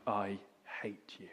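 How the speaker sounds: low hissed whisper through teeth; hissed hatred. Acted. Freed slave woman hissing at her buyer, low and bitter. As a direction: spit it out quietly with contempt. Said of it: "hissed through her teeth".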